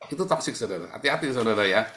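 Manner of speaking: normal talking voice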